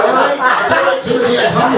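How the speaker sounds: high farmer voice. Acted. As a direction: call out to Timothy in a squeaky farmer voice.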